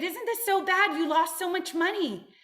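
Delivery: high, loud, fretting cry; dismayed workers. Acted. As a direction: wail it like worried farmhands. Workers lament to farmer.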